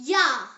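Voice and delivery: teacher model of Jahr with a Y sound; model pronunciation. Shown as a demonstration. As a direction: say Jahr like yah. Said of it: "Say it".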